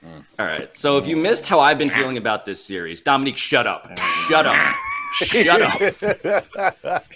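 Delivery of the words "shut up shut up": laughing shout. Real, not acted. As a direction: yell it through a laugh. Telling teammate to hush.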